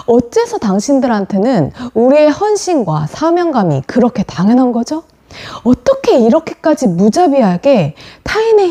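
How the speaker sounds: 높아진 음으로 따져 묻는 재연; 소리치지 않고 격앙되어 항변하듯; 분노를 토해내는 수간호사 흉내. Retold or imitated. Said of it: "뱃속 깊은 곳에서부터 막 어마어마한 소리로 제압을 하더니". (분노한 수간호사의 항변 재연)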